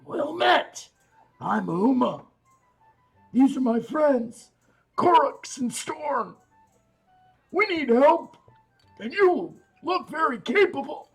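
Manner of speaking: raspy dwarf woman, high and loud. Acted. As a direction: rasp it out through a thick scarf. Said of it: "in a raspy voice".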